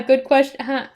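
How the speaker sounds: plain reading voice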